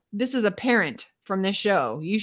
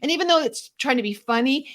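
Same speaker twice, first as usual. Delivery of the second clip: ordinary talk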